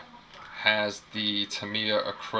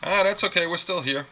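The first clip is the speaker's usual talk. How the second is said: excited, higher; 9 semitones higher, 6 dB louder than usual. Real, not acted. Recovers from hiccup, laughing.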